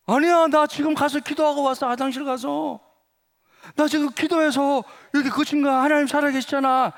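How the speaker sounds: excited, high-pitched re-enacted insisting; insistent protest. Student convincing classmate his prayer worked, excitedly insistent.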